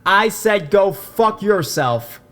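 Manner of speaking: slow, high, full-force shout; top-of-lungs yell. A narrator's reading. Drunk Jake yells back at the guy, defiant full-force shout. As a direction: belt out each word in defiance. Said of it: "yells at the top of his lungs".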